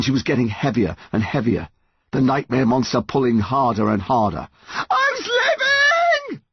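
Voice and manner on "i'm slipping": very high, drawn-out scream; terrified yell. A narrator's reading. Mum slipping from her son's grasp, yelling. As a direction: wail it in panic. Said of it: "his mum yelled".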